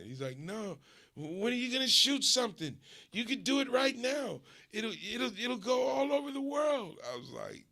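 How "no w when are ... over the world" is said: much higher, fast and louder urging, ending in a shout; pushy cousin. Retold or imitated. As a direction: push him to start right now. Cousin urging him to shoot now.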